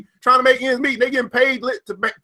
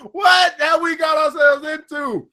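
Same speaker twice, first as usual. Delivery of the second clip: slow, higher, sob-tinged lament; mock crying lament; 5 semitones higher, slower than usual. Real, not acted. Vlogger despairing over America, slow mock-crying lament. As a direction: wail it slowly half-laughing. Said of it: "but I'm crying".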